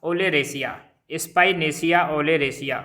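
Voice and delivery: "Spinacia oleracea" said very slowly, each syllable clear; slow dictation. Shown as a demonstration. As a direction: repeat "Spinacia oleracea" for the class to copy. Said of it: "मैं दो दो तीन तीन बार बोल रहा हूँ".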